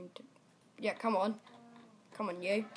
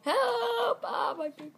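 high, loud mock cry for help; panicked cry; 8 semitones higher, 6 dB louder, much slower than usual. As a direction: wail as if your feet were hit. Player's character gets hurt in game.

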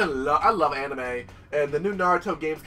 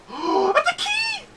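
high, slow thrilled squeal; wide-eyed delight at a key; excited gasp. Real, not acted. Excited find in game.

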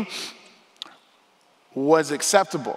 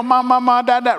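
mock baby babble; 8 semitones higher, 6 dB louder than usual. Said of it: "for a twenty-five-year-old to go".

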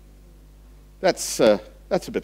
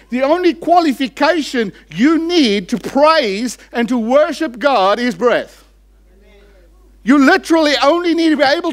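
raised, higher, louder sermon voice; fiery preaching; 9 semitones higher, 5 dB louder than usual. Acted. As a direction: preach it with rising fervour to the congregation. Preaching at full force.

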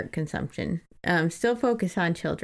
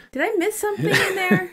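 high, fast, half-laughing question; baffled; 11 semitones higher, faster than usual. Real, not acted. Reader puzzled by the book's money detail, high and quick. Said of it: "confused by book detail".

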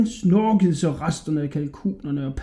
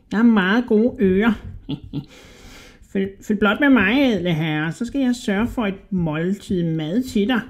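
high, squeaky, wheedling rat voice; sly little rat man; 5 semitones higher than usual. A narrator's reading. Little rat flattering a hungry Hodja, high and squeaky.

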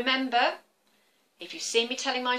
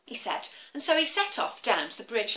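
ordinary delivery

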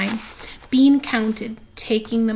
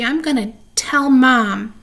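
put-on loud childish threat; loud tattling threat; 6 dB louder than usual. Sister threatening to tell Mom, loud yell. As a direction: shout it toward the house. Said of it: "yelled Nancy".

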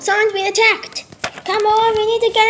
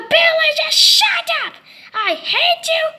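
angry outburst; 11 semitones higher than usual. Acted. Angry friend yelling at Billy before the fight. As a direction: snap at him in fury.

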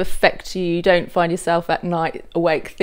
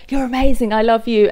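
higher, louder mock adoration; gushing praise; 4 semitones higher, 5 dB louder than usual. Interviewer imitating people who heap praise on the musician, mildly gushing. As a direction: imitate a gushing admirer, lightly. Voicing gushing fans in a question.

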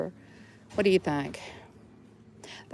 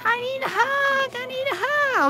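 squeaky plush toy voice. Pretend.

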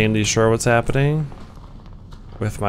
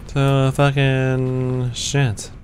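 drawn-out curse; 2 semitones higher, much slower than usual. Real, not acted. Frustrated in game.

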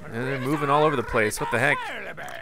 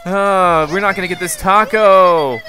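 loud frustration; 2 semitones higher, 9 dB louder, slower than usual. Real, not acted. Frustrated after losing taco.

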